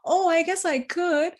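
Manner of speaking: high-pitched put-on surprised acceptance; acted surprise. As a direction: imitate someone pretending the offer is news. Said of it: "acting all surprised".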